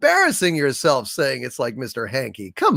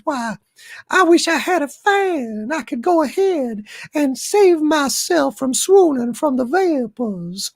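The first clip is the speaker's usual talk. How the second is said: flutter like a Southern belle with the vapors; very high, swooning Southern lady; 13 semitones higher than usual. Acted. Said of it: "turned Doomcock into a Southern Belle Doomcock".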